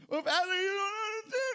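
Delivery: hammed-up crying protest to the pastor; whiny complainer. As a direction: whine like a fearful churchgoer pleading. Mocking a whining congregant.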